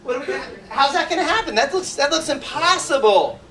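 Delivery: high, loud mock bewilderment; hammed-up incredulous protest; acted disbelief. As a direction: balk at it as if it cannot be done. Acts out doubt about horse.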